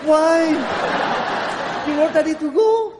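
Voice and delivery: high, rising lovesick whine; hurt pleading. Acted. Bị người yêu bỏ qua điện thoại. (năn nỉ người yêu, giọng cao mè nheo)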